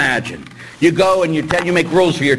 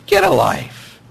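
exasperated retort; 12 semitones higher, slower than usual. Real, not acted.

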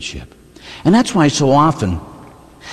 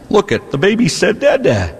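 proud dad; 8 semitones higher than usual. Retold or imitated. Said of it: "If you're a man, it's".

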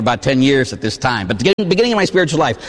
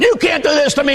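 retold panicked shout; screaming at the devil; 11 semitones higher than usual. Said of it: "And I'm screaming at the devil".

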